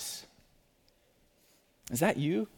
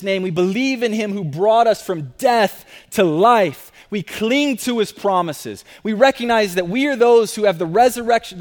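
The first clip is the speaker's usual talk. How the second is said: loud, higher confident declaration; triumphant preaching; 4 semitones higher, 4 dB louder than usual. Acted. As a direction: declare it boldly to the church.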